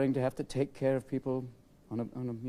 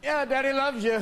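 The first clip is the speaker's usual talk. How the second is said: sleepy dad; 11 semitones higher, 4 dB louder than usual. Acted. Groggy dad to kids.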